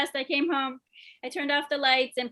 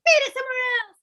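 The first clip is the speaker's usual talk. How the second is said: high, loud acted yell; louder, meaning it; 11 semitones higher, 6 dB louder, faster than usual. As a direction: belt it out like you mean it. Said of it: "Say it louder like you mean".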